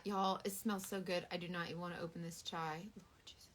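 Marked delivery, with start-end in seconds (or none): 3.0-3.5 breathy whispered Lord Jesus; whispered plea. Real, not acted. Dreading the chai scent, a faint whisper.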